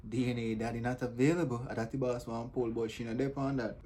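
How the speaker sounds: ordinary delivery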